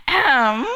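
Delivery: loud, staged "ahem"; acted loud throat-clearing; attention-getting throat clear. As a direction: clear your throat pointedly to be noticed. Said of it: "And I went".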